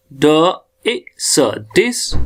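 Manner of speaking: ड, ए, स slowly apart, then blended as डिस; sounded out. Shown as a demonstration. Teacher spells out the transcription, separate ड ए स blended into डिस. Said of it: "phonetic transcription".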